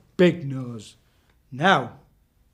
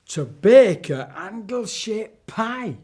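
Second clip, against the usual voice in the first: slow, loud gasp of dread; gasping horror; 6 semitones higher, 6 dB louder, slower than usual. Acted. Narrator gasping at the witch's plan, slow and loud. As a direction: gasp it out in shock.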